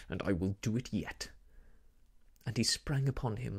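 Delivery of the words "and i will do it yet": low, menacing vow; dark, determined villain's threat. A narrator's reading. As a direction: threaten him in a lowered voice. Villain threatens his victim.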